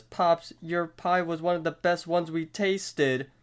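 lightly voiced pleading reply; Mordecai. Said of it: "then says".

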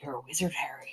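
put-on very quiet, breath-only Scottish accent; Hagrid. Mom doing her read-aloud Scottish giant for cohost, hushed. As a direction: half-whisper a tentative Scottish impression. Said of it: "like, Scottish accent".

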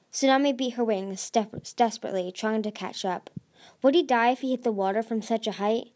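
plain reading voice

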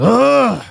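high, drawn-out mock "ugh"; put-on high little groan; imitated toddler grunt. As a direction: imitate a toddler's long groan after a trip. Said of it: "And he just goes".

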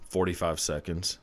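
normal talking voice

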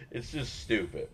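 ordinary delivery

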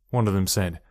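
plain reading voice

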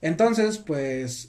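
normal talking voice

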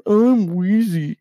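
high, drawn-out, loud swagger; stretched, self-important mock boast; mocking rapper's boast. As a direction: puff it up to ridicule him. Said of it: "Who does this motherfucker think he is".

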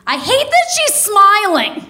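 lightly voiced upset mom, high; a touch of a fed-up mother; mom's exasperated voice. Quoting mom to EMT.

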